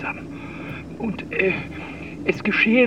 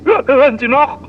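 much louder, higher stammered check; shouting into phone; 5 semitones higher, 11 dB louder than usual. Acted. Old man fearing the line dropped, loud call. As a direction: yell into the receiver.

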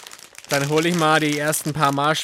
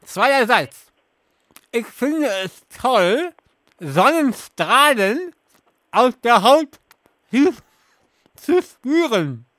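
talking through a mouthful of marshmallows; slow, stuffed-cheek speech; mouth full; 6 semitones higher, 4 dB louder, slower than usual. Real, not acted. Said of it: "mit vollem Mund alle Sätze vor".

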